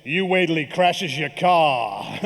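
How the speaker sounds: slow, knowing put-on voice of the cashier; wise cashier's voice. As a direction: deliver it slowly, knowingly. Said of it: "just said".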